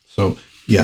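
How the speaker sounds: usual speaking voice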